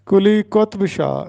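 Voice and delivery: قطب in کلی قطب Shah said qotb, ط without a vowel; درست تلفظ. Shown as a demonstration. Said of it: "جو کہ غلط ہے۔". (کلی قطب شاہ میں قُطْب، ط ساکن)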